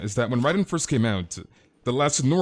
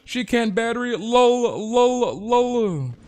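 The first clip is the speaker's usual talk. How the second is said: much higher, squeaky taunting parody; shrill-free high mock voice ending in louder lols; 11 semitones higher, 3 dB louder, slower than usual. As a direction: whine it like a smug troll.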